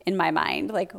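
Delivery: normal talking voice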